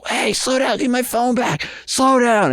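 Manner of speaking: high, strained yell without real volume; mock calling out. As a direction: re-enact shouting after a runner. Re-enacts yelling while chasing thief.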